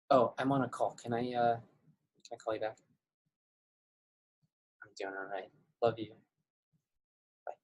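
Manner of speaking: hushed aside into the phone; quiet quick phone brush-off; dodging a caller. Real, not acted. Takes a phone call mid-lesson.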